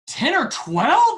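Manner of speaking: high, loud, drawn-out incredulous echo; freaking out. Retold or imitated. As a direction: imitate a coach aghast at the number, rising into a squeal. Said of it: "he's freaking out".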